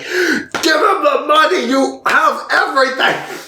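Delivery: hammed-up high-pitched begging; exasperated plea. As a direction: wail it in mock outrage.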